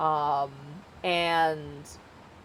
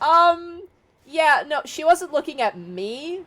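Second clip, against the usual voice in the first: high, louder flustered denial; nervous denial; 8 semitones higher, 5 dB louder than usual. Acted. Xanax dodges the question, high and flustered.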